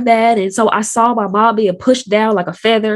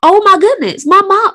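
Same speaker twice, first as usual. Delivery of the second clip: shocked; 9 semitones higher, 4 dB louder than usual. Retold or imitated. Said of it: "I'm just like".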